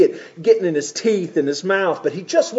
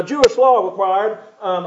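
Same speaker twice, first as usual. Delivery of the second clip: usual speaking voice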